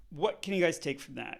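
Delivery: ordinary talk, nothing marked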